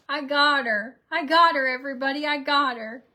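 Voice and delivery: loud, high gloat over the prank; triumphant gloating. Real, not acted. Mom crowing to viewers about her prank, loud and gleeful. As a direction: crow loudly that the prank worked.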